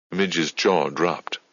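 ordinary delivery, nothing marked

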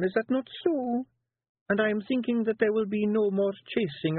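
a wife laying a trap sweetly; Kelda Jeanie. Acted. Jeanie voiced.

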